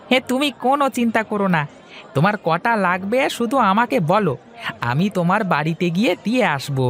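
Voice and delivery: put-on high, eager young man's voice; young fish seller Hari. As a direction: talk up to your elder brightly. Hari promises to deliver fish fry. (উঁচু গলায় উৎসুক তরুণ হরি)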